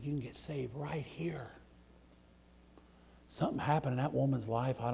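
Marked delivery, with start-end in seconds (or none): 0.0-1.5 whispered plea. Retold or imitated. Preacher whispering to the dying woman in intensive care. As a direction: breathe it to her softly. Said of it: "I was talking real low".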